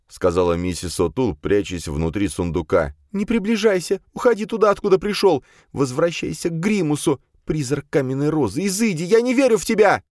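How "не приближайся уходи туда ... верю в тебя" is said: high-pitched frantic warding-off; high, desperate shouting; piercing cry. A narrator's reading. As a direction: cry out to banish the ghost. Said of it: "пронзительно завопила".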